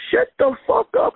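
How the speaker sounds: a hammed-up angry shout at a balcony singer; mock shout. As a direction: bark it like telling him off. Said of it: "I said".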